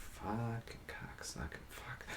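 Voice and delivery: very soft, slow swearing under the breath; muttered cursing. Retold or imitated. Marine retelling being called out, muttered curse. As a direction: curse to yourself so nobody hears.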